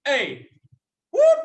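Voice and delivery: short A then a whoop; quick call and whoop; brief yell demo; senior wildcat yell. Shown as a demonstration. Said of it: "The senior Wildcat looks just like this".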